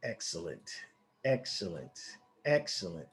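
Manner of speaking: soft, low, savoured approval; quiet satisfaction. Real, not acted. Trader watching price fall his way, quietly satisfied. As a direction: say it low, almost to yourself.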